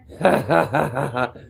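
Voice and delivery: loud laugh breaking out; burst of laughter. Real, not acted. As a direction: crack up at your own joke. Laughs at own joke.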